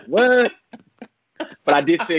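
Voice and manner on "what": high, louder burst of disbelief; surprised what. Retold or imitated. Guest retells his reaction to the news, loud high surprise. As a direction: pop the word up in amazement. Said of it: "I was like".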